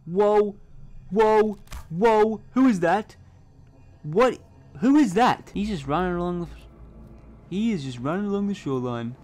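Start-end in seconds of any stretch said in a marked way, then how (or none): 0.0-7.8 high, loud whoas; loud astonished yelling. Real, not acted. Gamer yelling at a stranger on the shore. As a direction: shout in disbelief.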